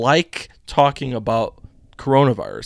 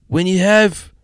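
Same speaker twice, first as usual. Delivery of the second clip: a hint of Fauci, higher and louder; Doctor Fauci impression; 8 semitones higher, 7 dB louder, slower than usual. Host retries the Fauci voice, lightly mock. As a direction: start a mock Fauci quote, then falter. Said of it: "I can't even do my Doctor Fauci".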